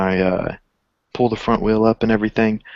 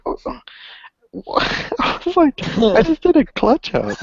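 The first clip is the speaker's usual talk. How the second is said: much higher, squeaky stunned disbelief; incredulous disbelief. Retold or imitated. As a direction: act floored by something absurd. Retelling disbelief at kid's claim.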